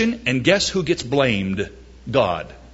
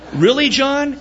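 mock incredulous; 10 semitones higher, 3 dB louder than usual. Listener objecting.

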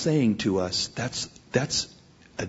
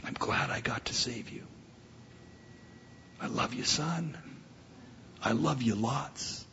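very soft, near-whispered hospital-bed voice; weak voice; 13 dB quieter than usual. Pretend. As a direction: play an injured father, barely able to speak. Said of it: "I say in my weak voice".

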